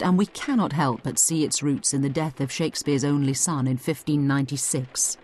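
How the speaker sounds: ordinary delivery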